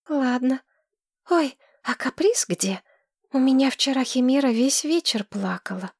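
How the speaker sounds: put-on high, breathless girl gushing in love; dreamy young woman. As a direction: swoon over him like a smitten girl. Tamira voice again.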